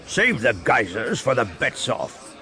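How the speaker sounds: resonant unseen crime boss; disembodied baritone voice. A narrator's reading. Unseen boss offering guests drinks, resonant baritone. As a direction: suggest it as a resonant, hidden host. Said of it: "a disembodied baritone voice suggested".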